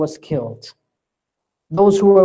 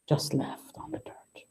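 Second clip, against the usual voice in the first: hushed whisper of "just left on the dirt"; 14 dB quieter than usual. Acted. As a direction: breathe the words out like a secret grief. Retelling the riwayah.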